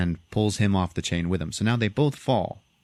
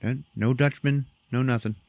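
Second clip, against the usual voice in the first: normal talking voice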